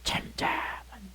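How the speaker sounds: very soft, unvoiced whisper; hushed. Shown as a demonstration. Preacher shows Aaron silent before God, hushed whisper. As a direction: drop right down to a whisper.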